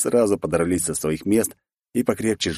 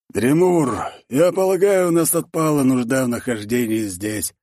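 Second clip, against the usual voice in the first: higher-pitched character voice for a dwarf; dwarf Torgvald; 6 semitones higher than usual. Acted. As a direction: call out to a comrade, pitched up and firm.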